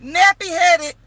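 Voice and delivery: can't believe what she's telling; real amazed shock; shocked disbelief. Real, not acted. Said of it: "in shock".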